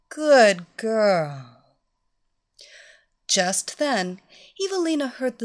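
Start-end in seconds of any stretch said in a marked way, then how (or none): 0.1-1.5 loud, satisfied praise; praising mistress. Acted. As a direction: reward her warmly and proudly.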